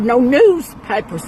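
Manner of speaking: NEWSPAPERS punched high and loud; exasperated correction. Retold or imitated. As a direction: correct them loudly, leaning hard on NEWS in newspapers. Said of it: "I can't understand what you're saying".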